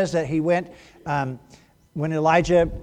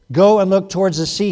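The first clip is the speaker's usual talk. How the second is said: command; 3 semitones higher, 8 dB louder than usual. A narrator's reading. Elijah commands his servant.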